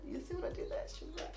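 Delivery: high, breathy, whispered repeat of the question; squeaky aside. Real, not acted. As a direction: tease them with a tiny high voice.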